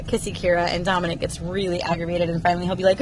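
ordinary delivery

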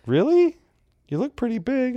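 very high mock female voice; high-pitched incredulous imitation; disbelieving woman. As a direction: squeak it up, imitating an amazed stranger. Voicing the woman, not believing him.

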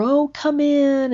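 high, slow sing-song welcome; lilting hostess greeting at the door; mock sugary come in; sweet welcome.

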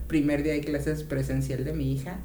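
ordinary talk

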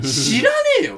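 high, loud and slow: 知らねえよ; exasperated shout. Real, not acted. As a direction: snap back in exasperation. Exasperated retort to junior's question.

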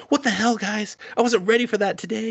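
high-pitched mock panic; super nervous. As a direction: imitate a nervous, flustered protest. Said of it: "Tomo's super nervous".

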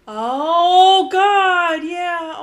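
loud, sudden realisation; surprised outburst. Real, not acted. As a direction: blurt it out in shock. Realising who the character is.

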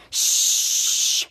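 drawn-out "shh"; long voiceless shush; long shushing hiss. Acted. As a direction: hush someone with a sustained shush. Character hushes another in scene.

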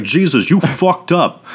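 hammed-up Brooklyn accent, pitched high. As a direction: do a bad Brooklyn accent, breaking into laughter. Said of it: "a Brooklyn accent and everything".

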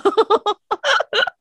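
high, bursting laugh; real laugh. Real, not acted. Wife cracking up over her Vegas story, laughing. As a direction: crack up at your own story.

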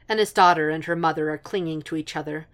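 plain reading voice

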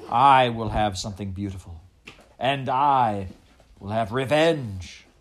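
loud, emphatic declaration; defiant vow. A narrator's reading. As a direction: proclaim it forcefully, slowing on the revenge. Rat vows beauty and revenge.

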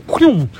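sharp, high, loud whoosh; quick sound effect of something flung away. Retold or imitated. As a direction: mimic a flying object with one loud whew. Imitates the thrown knife flying away.

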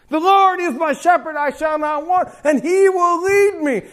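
shouted psalm, slowing at the end; proclaimed scripture. Acted. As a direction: declare the psalm to the room. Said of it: "psalm quoted".

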